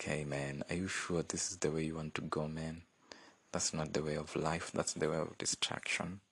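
a kind God pleading quietly with a sinner; soft, pleading voice of God; gentle God. Retold or imitated. God voiced, kindly warning sinner.

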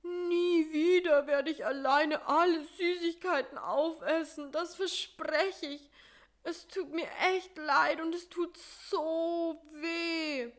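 high, whiny, pained promise; high whiny voice, slowing at the end; whining. Acted. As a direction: whimper it out like a sorry little creature. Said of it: "dem jammernden Karl-Heinz".

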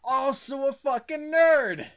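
high, loud proclamation; big mocking reveal; gleeful announcement. Real, not acted. Mock announcement that Destiny is nerd.

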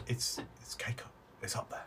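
very soft, voiceless whisper; secretive, barely audible murmur. A narrator's reading. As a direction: pass it on in a hushed whisper. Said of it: "Elwyn whispers to you".

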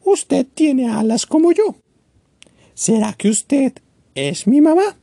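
put-on high, small childlike bird voice; a tiny chick asking hopefully; little bird Choco. Choco asks the penguin. (pajarito Choco, voz aguda e infantil)

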